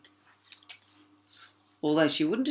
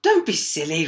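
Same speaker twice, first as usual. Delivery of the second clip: louder princess retort; 3 semitones higher, 9 dB louder than usual. A narrator's reading. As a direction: snap back at the frog. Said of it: "retorted the princess".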